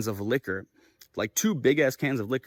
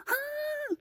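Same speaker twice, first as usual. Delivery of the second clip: high, soft mock gasp; small high-pitched startled "ah"; imitated startled gasp; 9 dB quieter than usual. Girlfriend spots the man coming, imitated high gasp.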